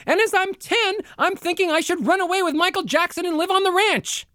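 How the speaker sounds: squeaky top of the range, far too high; extremely high. Shown as a demonstration. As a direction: go to the very top of your voice. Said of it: "my demonstration for pitch".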